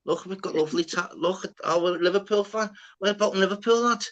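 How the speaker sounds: high, put-on Scouse accent, trying too hard; [name] faking Scouse. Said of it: "He's like".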